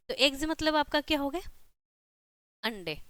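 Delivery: ordinary talk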